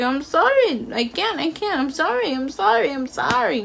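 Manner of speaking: high-pitched imitation of the driver's repeated apologies; mock driver apology. Imitating the driver apologizing to her, high and mocking. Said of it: "he was like".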